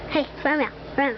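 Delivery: normal talking voice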